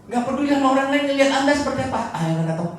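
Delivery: very high, loud impassioned sermon; full-force preaching. Real, not acted. Preacher on God seeing you as he sees his grandson, impassioned. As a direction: cry it out to the whole room.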